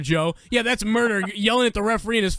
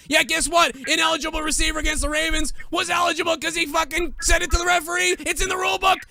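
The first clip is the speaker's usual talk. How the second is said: unhinged rant; 5 semitones higher than usual. Real, not acted. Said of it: "Did [name] forget his Xanax again?".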